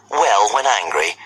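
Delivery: usual speaking voice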